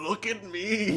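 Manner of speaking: mocking. The king mocks the heroine's claim, laughing and sneering. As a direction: parody her boast with a laugh, slow on chosen one. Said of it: "He mocks the chosen one?".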